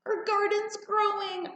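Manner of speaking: high, excited shout of joy; child's yell. A narrator's reading. Girl shouting to her mother about the garden, high and excited. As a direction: cry it out like a thrilled little girl. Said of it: "I yelled".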